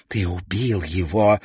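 slow hiss of accusation. A narrator's reading. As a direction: hiss it through the teeth. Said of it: "прошипела она". (прошипела)

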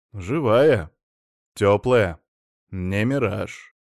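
ordinary delivery, nothing marked

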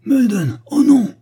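very high, very loud cry; anguished howl. A narrator's reading. Carruthers cries out as his garden gnome is shot, very high and very loud. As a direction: howl in grief for your fallen man. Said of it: "hurla Carruthers, le visage tordu de rage".

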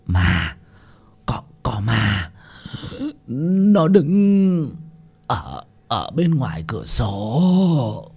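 put-on slow, halting, scared old man; stuck on words, drawn out with fright; frightened stammer. A narrator's reading. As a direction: stammer it out, dragging the words in fear. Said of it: "Lão Chánh Toàn lắp bắp".

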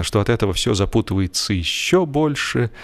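ordinary delivery

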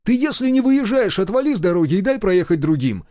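loud, high-pitched telling-off; irritated. A narrator's reading. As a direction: shout at the dawdler, fed up. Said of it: "раздражённо бросил я".

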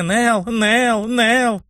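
high, teasing name-calling; mock calling out. A narrator's reading. Teasing what her friend cried in sleep, high mock calls. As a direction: mimic her cries in a high voice.

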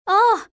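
a sudden loud, high cry of fright; startled scream. A narrator's reading. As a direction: scream as if caught off guard. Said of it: "فتصرخ ريتال بصوت عال". (صرخة فزع عالية)

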